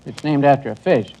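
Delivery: ordinary talk, nothing marked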